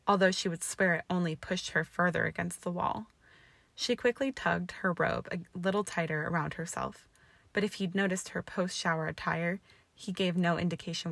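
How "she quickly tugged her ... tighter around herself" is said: ordinary delivery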